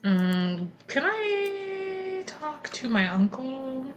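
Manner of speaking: ordinary talk, nothing marked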